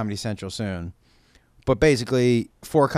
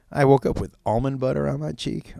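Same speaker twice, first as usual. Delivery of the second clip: ordinary talk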